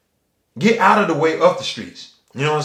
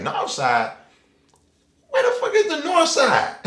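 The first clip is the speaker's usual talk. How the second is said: much higher, laughing disbelief; confused disbelief; 10 semitones higher than usual. Retold or imitated. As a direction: act baffled by a place nobody knows. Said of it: "We looking like everybody in that bitch like".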